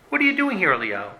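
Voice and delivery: high-pitched demand, dropping on the name; exasperated challenge. Acted. God confronts Eliyahu in his pity party, exasperated. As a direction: challenge him high, then drop away.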